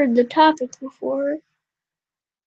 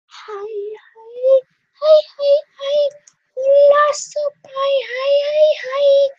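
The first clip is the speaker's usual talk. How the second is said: high excited greeting; 12 semitones higher, 4 dB louder than usual. Acted.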